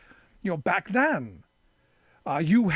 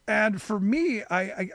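normal talking voice

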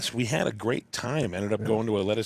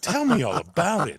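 high, loud, hammed-up eagerness; excited bartender imitation; 9 semitones higher, 7 dB louder than usual. Bartenders begging the visitor for convention stories, playfully over-excited. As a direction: gush it as a thrilled bartender. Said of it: "they were also enthusiastic and excited".

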